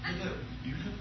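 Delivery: very soft, timid British greeting; imitating mom's British phone message; scared little British voicemail voice; British accent. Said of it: "phone message is British".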